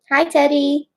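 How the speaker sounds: high, cutesy hello; high pet-greeting voice. Real, not acted.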